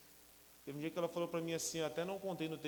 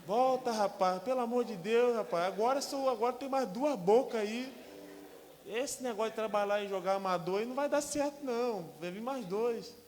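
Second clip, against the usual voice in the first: high, loud pleading command; urgent shouting father; 8 semitones higher, 10 dB louder than usual. Retold or imitated. Father begging his son to return to football, loud and urgent.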